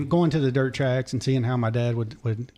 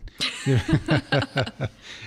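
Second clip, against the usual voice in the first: laughing; 14 semitones higher than usual. Real, not acted. Laughs at own slip.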